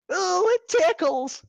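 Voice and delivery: very high, slow squeal; squealing giggly. Acted. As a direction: squeal with tickled delight. Pinkie squealing, much higher.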